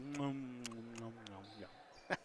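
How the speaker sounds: soft, low nom nom fading to a breath; a murmured nom nom going unvoiced at the end; imitated crowd murmur. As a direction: hum "nom nom" like a distant chattering crowd. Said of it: "all the people just like".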